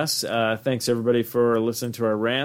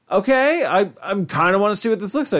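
higher, hopeful, eager; mock eager kid; 7 semitones higher, faster than usual. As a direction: sound like a kid hoping the monster is cool. Voicing his younger excited self.

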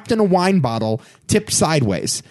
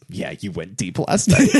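eye-roll mockery; 8 semitones lower than usual. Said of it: "with this roll of the eye and just goes".

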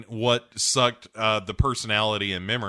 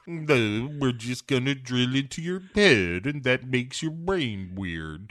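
Blofeld; 3 semitones higher, slower than usual. Acted. Blofeld explaining the drill to Bond, drawn-out villain impression. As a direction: stretch the explanation out in a put-on voice. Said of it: "he's basically like".

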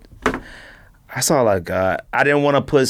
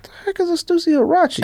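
high, fast disbelieving question; can't believe what he just heard; incredulous; faster than usual. Real, not acted. Disbelief at co-host's sneaker pick.

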